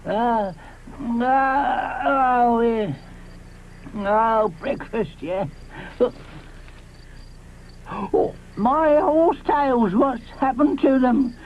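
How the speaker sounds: put-on slow, old and groaning; dismayed Grandpa Diplodocus. Grandpa Diplodocus finds his horsetails ruined, slow groaning dismay. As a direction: wake groggy, then aghast.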